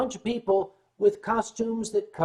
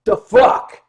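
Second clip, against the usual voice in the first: loud New York bark; New Yorker rejecting the explanation; New York tough guy; 4 semitones higher, 14 dB louder than usual. Retold or imitated. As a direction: blurt it like an annoyed New Yorker. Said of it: "New Yorkese".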